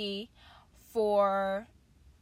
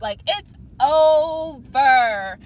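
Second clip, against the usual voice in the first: slow, much louder declaration; emphatic hype; 2 semitones higher, 11 dB louder, much slower than usual. Real, not acted. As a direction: drag it out loudly for emphasis. Hyped about coming book scene.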